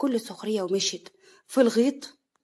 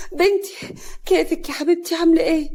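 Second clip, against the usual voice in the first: higher, louder anxious mother; worried, urgent checking on her child; fearful; 7 semitones higher, 5 dB louder than usual. Acted. Mother anxiously checking on her daughter, fearful. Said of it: "نوال بخوف".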